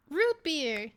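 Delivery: sudden recall. Real, not acted. Remembering the drink's name after forgetting it, excited recall. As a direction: blurt it out as it comes back.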